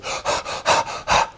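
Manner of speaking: short, fast, audible puffs of breath; quick panting. Shown as a demonstration. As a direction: demonstrate rapid, forceful breathing. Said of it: "very quick breathing movements".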